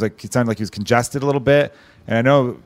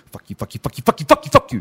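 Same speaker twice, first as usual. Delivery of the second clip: escalating fuck-yous; 2 semitones higher than usual. Retold or imitated. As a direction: build it like a dog's escalating bark. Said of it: "escalating fuck yous".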